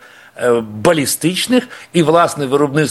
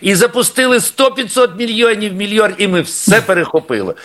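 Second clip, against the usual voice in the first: higher-pitched mock report of a grand claim; mocking Russian boast; 7 semitones higher than usual. Guest parodies Russian claims of intercepting all missiles, mock boasting. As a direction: imitate an overblown boast, pitched up. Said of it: "росіянці кажуть".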